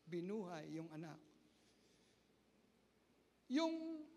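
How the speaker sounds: ordinary delivery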